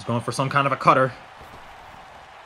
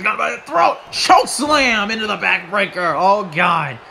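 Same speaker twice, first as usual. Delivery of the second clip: higher, raised-voice play-by-play; thrilled commentator reaction; excited call; 5 semitones higher, 4 dB louder than usual. Real, not acted. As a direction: build through the throat grab into the slam. Calling choke slam.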